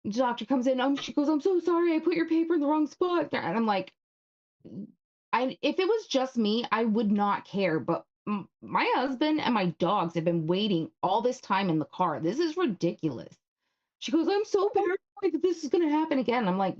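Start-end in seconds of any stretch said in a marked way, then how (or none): 1.3-3.3 much higher, sing-song sorry voice; mock apologetic doctor. 14.3-16.5 much higher, fast, put-on whiny voice; mocking the doctor. Mocks the doctor's excuse.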